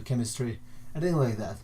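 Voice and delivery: normal talking voice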